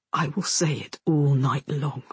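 low, tender man's voice; lightly voiced man, earnest; soldier's vow. A narrator's reading. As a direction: promise it low and close. Soldier vows softly to girl.

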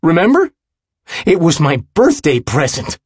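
loud, high, biting reminder; sarcastic. A narrator's reading. Willem reminds his friend of a promise, sarcastic. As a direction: throw the promise back sarcastically. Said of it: "The final words sound more sarcastic".

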